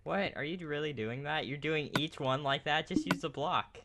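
high, loud incredulous questions; surprised disbelief. Real, not acted. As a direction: react in startled disbelief. Startled at friend's building method.

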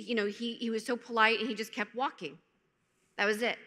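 ordinary talk

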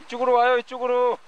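loud call, stress on 와요; yelling across the room. Real, not acted. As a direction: call out, 이쪽으로 와요 high. Calling people over again.

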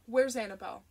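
loud, happy call across the yard; father calling to Sherry from the truck, loud and grinning. A narrator's reading. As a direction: call out eagerly for your daughter. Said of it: "he called to Sherry".

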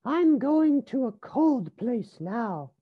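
high character voice; little rabbit Rosie. A narrator's reading. Rosie announces a cold trip, high little voice. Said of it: "said Rosie".

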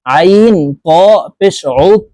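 ordinary delivery

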